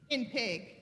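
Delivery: ordinary delivery, nothing marked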